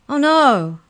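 high, loud dismayed groan. A narrator's reading. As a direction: moan in dread of the dogs. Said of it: "groaned Molly".

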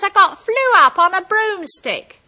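high, loud call down to the ground; shouted reply. Acted. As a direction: holler the answer down.

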